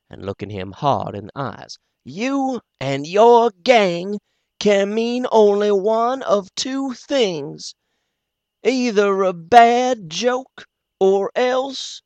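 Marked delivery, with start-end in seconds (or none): 2.0-12.0 high, slow, grave warning; slow and deliberate, holding back; doctor voice. Acted. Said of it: "very quiet, but raising his voice a little".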